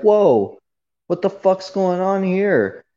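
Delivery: high-pitched, acted-out bewilderment; retold startled reaction. Reacting to a sudden fight in the chat, retold bewilderment. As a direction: act taken aback, pitch jumping up.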